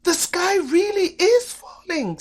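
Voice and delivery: lion screams that the sky is falling. A narrator's reading. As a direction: scream in terror. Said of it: "screamed the lion".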